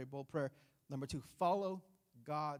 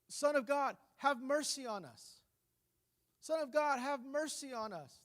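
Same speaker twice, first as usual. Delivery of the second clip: higher, louder pleading call; crying out for mercy; 6 semitones higher, 4 dB louder than usual. Retold or imitated. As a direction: call out to Jesus as he passes by. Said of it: "he hears this cry".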